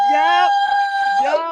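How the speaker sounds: high, loud happy yep; excited yep. Real, not acted. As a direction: shout it with delight. Excited confirmation.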